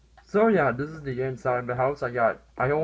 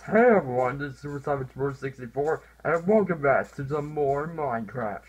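normal talking voice

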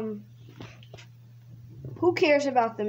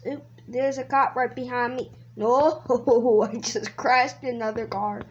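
normal talking voice

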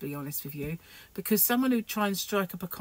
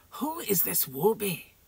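slow, mock Scottish accent quoting the comedian; a light-hearted stab at a Glasgow accent, slow; 3 semitones higher than usual. Quoting the big yin to viewers in a mock Scottish accent. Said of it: "Sorry about the Scottish accent".

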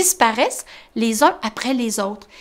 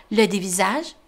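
ordinary delivery

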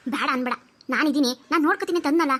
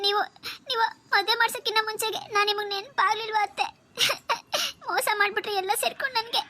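put-on higher, whimpering young girl's complaint; tearful niece; 6 semitones higher than usual. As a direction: plead through half-sobs like a betrayed girl. Girl complains tearfully to aunt.

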